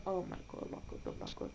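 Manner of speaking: breathless whispered disbelief; stunned whisper. Real, not acted. Streamer reacting to a game twist, stunned whisper. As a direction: barely get the words out in shock.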